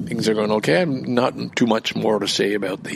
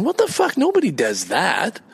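outraged disbelief; 6 semitones higher than usual. Real, not acted. Outraged at the rude waving.

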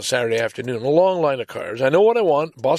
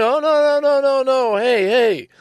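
high, loud rapid-fire string of no's; alarmed protest; 9 semitones higher, 5 dB louder than usual. Retold or imitated. Customer protesting to the app over a surprise charge, alarmed. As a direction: re-enact a panicked objection, pitch shooting up.

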